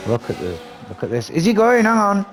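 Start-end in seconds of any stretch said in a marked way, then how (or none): 1.3-2.2 high, loud urgent calls; excited play-by-play. Real, not acted. Commentator seeing a driver dive for the pass, excited. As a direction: call the move as it unfolds. Overtake starting live.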